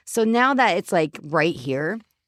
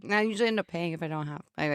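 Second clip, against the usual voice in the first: normal talking voice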